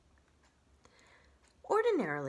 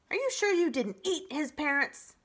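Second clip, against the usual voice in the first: louder, higher squawky question; suspicious goose; 8 semitones higher, 6 dB louder than usual. Acted. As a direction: squawk it accusingly. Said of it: "squawking goose".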